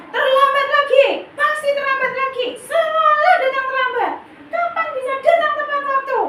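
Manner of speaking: high, loud scolding of a late pupil; scolding teacher. Shown as a demonstration. Punisher teacher scolding a late pupil, high and loud. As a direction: glare and snap at the latecomer, voice way up. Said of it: "nada suara tinggi, mata melotot, menghardik".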